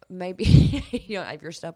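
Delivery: laughing through tears; a crying laugh; teary laugh. Real, not acted. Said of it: "Well, I'm trying not to cry".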